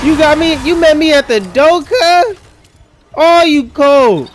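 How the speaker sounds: loud, high-pitched indignant yell; shouted, high complaint; outraged protest. Real, not acted. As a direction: call out a dirty ambush. Protesting an ambush in game.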